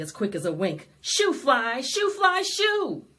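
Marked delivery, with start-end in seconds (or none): none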